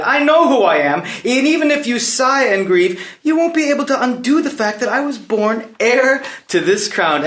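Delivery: high, forceful proclamation; defiant outburst. Acted. Prince claims his crown against the king, defiant outburst. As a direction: throw it at him, pitch soaring.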